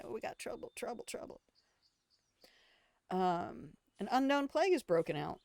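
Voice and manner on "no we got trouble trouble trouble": whisper. Pretend. Whispered aside of the song.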